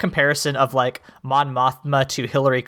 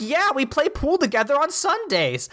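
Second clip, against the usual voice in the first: much higher, breezy put-on voice; mock clueless husband; 11 semitones higher than usual. Husband shrugging off the war criminals, high and breezy. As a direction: toss it off high and carefree.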